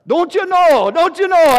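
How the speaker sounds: loud, high, hammed-up Minnesota accent. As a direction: exaggerate a folksy Minnesota "don't you know". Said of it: "I think it's Minnesota".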